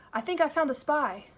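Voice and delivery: high, loud, tumbling rush of words; breathless gabbling. Acted. Girl gabbling urgently to the desk policeman. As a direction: blurt it out all at once. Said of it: "the way you're gabbling".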